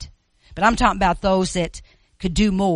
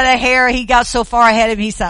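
ordinary delivery

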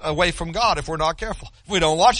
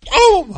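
high, loud "Boom!" sound effect; imitated door bang; 11 semitones higher, 8 dB louder than usual. Grandfather mimics the door as granddaughter bursts in, loud bang. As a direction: slam it out like a door flying open.

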